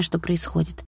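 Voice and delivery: ordinary delivery, nothing marked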